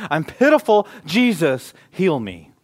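loud high plea; pleading cry. Retold or imitated. Blind man begging Jesus, loud high plea. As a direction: beg for healing.

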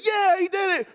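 high, loud victory shout; cheering. Retold or imitated. Kids on the bed cheering their friend's win, shouting. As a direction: erupt like your team just won the Super Bowl.

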